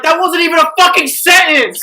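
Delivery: loud, high re-enacted yell; exasperated retold outburst. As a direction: yell it back in mock outrage. Retold exasperated reply to bad joke.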